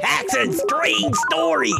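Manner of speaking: high, slow, spooky whispered voice; mad scientist. Pretend. Mad scientist bit on podcast.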